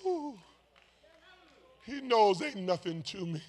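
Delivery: ordinary talk, nothing marked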